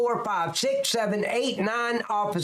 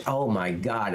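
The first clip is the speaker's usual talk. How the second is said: ordinary delivery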